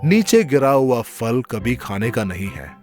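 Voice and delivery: plain reading voice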